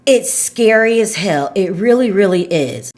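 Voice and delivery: usual speaking voice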